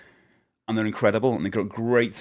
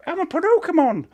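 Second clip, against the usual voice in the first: silly creature voice; 5 dB louder than usual. Pretend. Silly made-up creature name.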